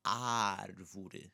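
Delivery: árvore with a long, wide first A; árvore drawn out on AH; open A. Shown as a demonstration. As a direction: stretch the open "ah" starting árvore. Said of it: "notice my mouth as I pronounce it".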